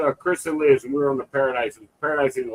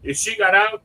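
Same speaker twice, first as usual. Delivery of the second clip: normal talking voice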